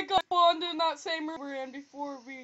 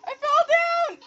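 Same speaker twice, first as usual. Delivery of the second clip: loud high shouts for help; calling for help; 10 semitones higher, 14 dB louder than usual. Real, not acted. Fallen player shouts to his friend for help, loud and high. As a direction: yell across to your friend.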